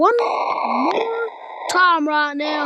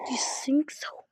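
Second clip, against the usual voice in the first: taunting whisper; 2 semitones lower, 9 dB quieter, slower than usual. Acted.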